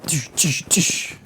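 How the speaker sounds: sharp, hissed "tish" impacts; imitated punch sounds. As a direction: make quick punching noises with your mouth.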